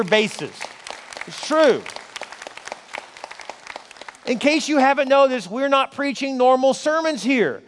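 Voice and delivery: loud, driving preacher's push; fired-up preaching. Acted. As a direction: preach it to the back row with full force. Preaching at full force.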